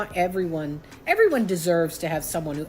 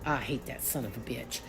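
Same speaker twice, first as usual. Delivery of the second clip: very quiet, low and level; hushed verdict; 6 semitones lower, 10 dB quieter than usual. Retold or imitated. Friend hearing about the cheating, hushed loathing. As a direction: say it under your breath, pitched down.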